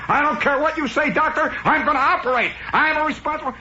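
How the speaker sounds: high, hammed-up doctor's outburst; mock angry doctor. TV doctor overruling a colleague, mock angry yelling. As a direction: bellow with exaggerated authority. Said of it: "Where's that angry doctor".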